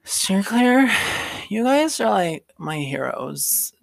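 put-on high, gushing drunk sorority girl; tipsy girl overflowing with thanks. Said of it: "And then the drunk girl is".

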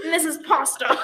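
hammed-up "This is pasta." with a laugh in it; goofy mock declaration of pasta; silly mock announcement. Friends joking about pasta, a laughing mock announcement.

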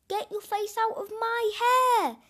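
high, loud yell back. A narrator's reading. Small boy yelling back at his teen brother. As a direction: yell it right back at him. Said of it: "yelled Hamish".

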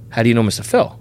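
level, guarded question; suspicious, cold. Retold or imitated. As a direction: turn wary and cool. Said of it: "The tone changed".

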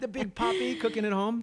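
a deliberately bad Dominican accent; hammy high Dominican accent. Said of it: "I'm doing a really bad Dominican accent".

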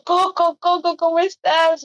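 high, slow sobbing stammer; mock crying. Tearfully asking Luis how he is, mock crying. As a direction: stutter the question through fake tears. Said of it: "yo llorando".

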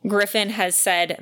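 ordinary delivery

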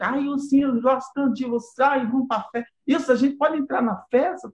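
put-on high wheedling child voice; sweet-talking kids. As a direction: fawn like eager little kids. Kids sucking up to get in.

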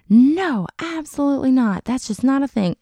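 high, loud, adamant retold "no"; emphatic refusal. Retelling her answer on men and women being friends, adamant refusal. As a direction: shut the question down with exaggerated certainty.

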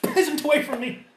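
high, loud, snooty aristocrat voice; haughty nobleman. Acted. The cornered noble snaps at the peasant party, high and shrill.

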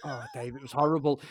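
normal talking voice

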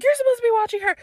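mock parental reproach in a high voice; high-pitched nagging parent; scolding parents. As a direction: imitate a parent telling off the babysitter. Parents scolding her in retold story.

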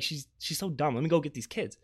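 normal talking voice

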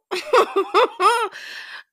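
high, loud burst of laughter. Real, not acted. Speaker amused at her own story, high laugh.

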